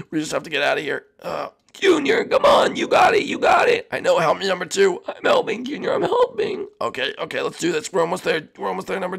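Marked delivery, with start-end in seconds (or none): 1.7-6.7 very high urging shout; cheering Junior through the fire; high excited cheer. Acted.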